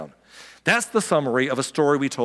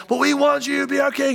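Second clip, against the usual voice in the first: pleading crowd voice; 10 semitones higher than usual. Retold or imitated. Crowd begging Jesus.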